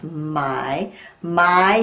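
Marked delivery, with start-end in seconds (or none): 1.2-1.8 My said loud with a long I; loud teacher model of My; stretched my. Shown as a demonstration. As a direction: lean on the I in My. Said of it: "Hear the I?".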